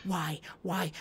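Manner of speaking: ordinary talk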